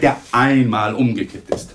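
usual speaking voice

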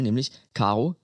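ordinary talk

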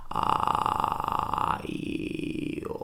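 soft, drawn-out vocal fry; tired creak, crackling slowly through the vowels; creaky morning voice. Shown as a demonstration. Teacher acting just woken and tired, creaky voice. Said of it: "diese Stimmbänder jetzt ganz langsam vibrieren lässt".

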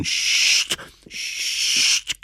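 tonloses Zischen für einschlagende Blitze; zischende Blitze. A narrator's reading. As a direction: zisch wie ein Blitz. Geräusch der Blitze nachgemacht. (tonloses Zischgeräusch)

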